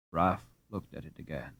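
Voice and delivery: usual speaking voice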